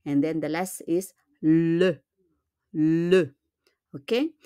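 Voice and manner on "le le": "le" said as a deliberate "luh"; short rounded vowel ending "le"; unaccented French e. Shown as a demonstration. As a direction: linger on the L of "le". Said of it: "we pronounce it as e".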